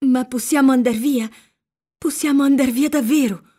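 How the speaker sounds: usual speaking voice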